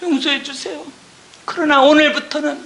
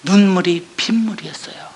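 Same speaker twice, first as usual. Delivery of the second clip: whispered; 8 semitones lower than usual. Real, not acted. Recalling tears over the verse.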